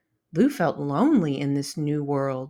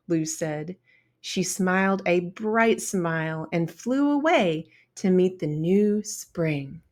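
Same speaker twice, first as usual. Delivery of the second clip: ordinary delivery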